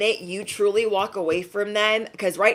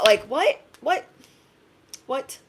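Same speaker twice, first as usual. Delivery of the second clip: ordinary talk, nothing marked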